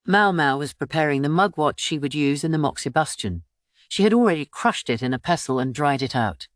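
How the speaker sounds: ordinary delivery, nothing marked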